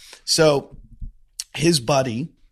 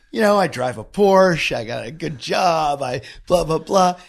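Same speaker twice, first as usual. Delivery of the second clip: mock bragging; 5 semitones higher, 5 dB louder than usual. Imitating boastful friend.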